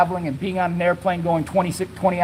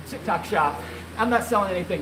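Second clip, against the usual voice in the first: normal talking voice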